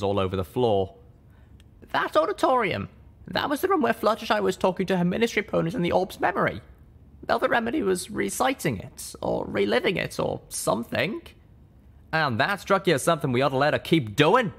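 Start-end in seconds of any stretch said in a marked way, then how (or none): 1.9-11.2 much higher, hurried explaining; flustered young mare. Acted. Littlepip voice. 12.1-14.5 higher sharp retort; snapped. Acted. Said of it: "Calamity snapped".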